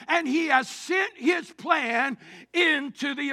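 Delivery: loud, rising refrain it's not God's fault; fervent preacher's call and response; full-force preaching. Acted. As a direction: hammer the refrain home.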